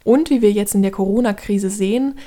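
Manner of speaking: usual speaking voice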